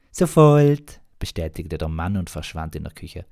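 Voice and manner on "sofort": put-on very high, loud and slow young host; innkeeper voice. Young innkeeper greeting a guest, very high, loud and slow. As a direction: greet the customer in a squeaky high voice.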